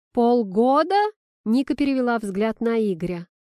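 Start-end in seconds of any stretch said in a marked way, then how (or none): 0.1-1.0 loud question rising at the end; incredulous echo. A narrator's reading. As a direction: throw it back as a disbelieving question. Girlfriend repeats the news in disbelief. (изумлённый громкий переспрос)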